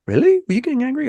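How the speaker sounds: put-on high-pitched, puzzled child's question; imitating surprised son. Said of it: "I said sorry to my son; he's like".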